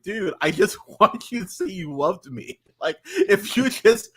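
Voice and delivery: cracking up mid-quote; laughing while pleading; laughing through words. Retold or imitated. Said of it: "Dewey Cox ... is like".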